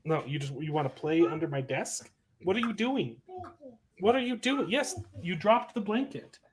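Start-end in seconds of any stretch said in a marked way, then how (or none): 2.4-6.4 tender, higher voice for his daughter. Real, not acted. Talking to his small daughter.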